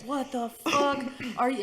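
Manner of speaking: boyfriend's drawn-out dismay, retold; imitated disbelief. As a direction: stretch it out, appalled. Boyfriend reacting to her puking.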